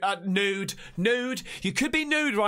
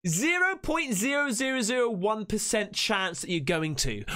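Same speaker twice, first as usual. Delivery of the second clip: ordinary talk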